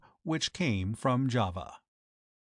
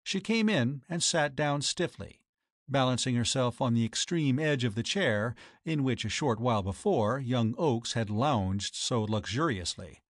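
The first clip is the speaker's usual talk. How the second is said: ordinary delivery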